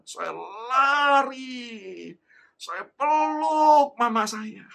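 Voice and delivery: loud, high, hugely stretched words; excited reunion. Retold or imitated. Child running to hug found mother, excited drawn-out cry. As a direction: cry it out with swelling joy.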